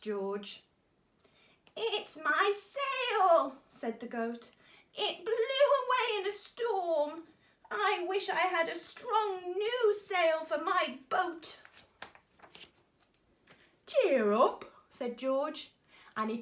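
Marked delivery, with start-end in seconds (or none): 1.8-11.6 high, loud, bleating goat. A narrator's reading. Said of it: "bleating loudly". 13.9-14.6 1 hearty cheer. A narrator's reading. George comforting goat.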